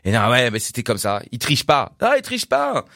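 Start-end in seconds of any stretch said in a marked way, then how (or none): 2.0-2.8 much higher, emphatic admiration; excited insistence. Real, not acted. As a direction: insist with delight that he doesn't cheat.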